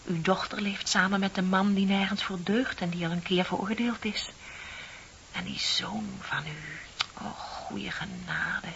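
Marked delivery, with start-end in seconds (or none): 5.3-8.7 soft and slow, almost breathed; a hushed, slowed aside of false sympathy; hushed mock pity. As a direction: lean in and pity her spitefully. Mock pity about the son.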